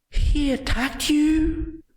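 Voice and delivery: very high, slow, loud giant gasp; the giant outraged that his master was hurt; shocked giant. A narrator's reading. Giant alarmed on master's behalf.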